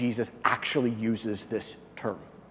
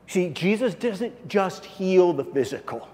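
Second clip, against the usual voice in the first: ordinary delivery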